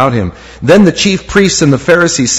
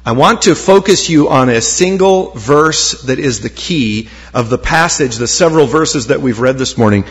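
normal talking voice

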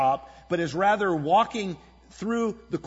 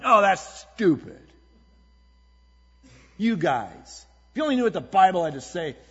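mock scornful; 3 semitones higher, 6 dB louder than usual. Scornful arguing at work.

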